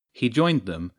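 ordinary delivery

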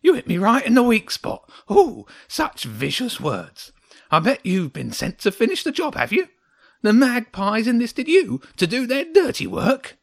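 high, loud, accusing little creature; wounded and indignant; grumpy hedgehog, aggrieved. A narrator's reading.

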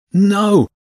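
loud high cry of alarm; desperate shout. A narrator's reading. James cries out as the blade swings at Jenny, loud desperate shout. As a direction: scream it to stop the blade.

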